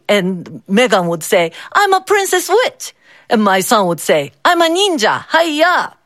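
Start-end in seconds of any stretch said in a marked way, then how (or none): 1.7-2.9 put-on high, sweet child voice; proud little princess witch; little girl voice. A narrator's reading. Child's costume answer, high voice. 4.5-5.9 put-on high, bold child voice with a karate yell; proud small ninja; little boy ninja. A narrator's reading.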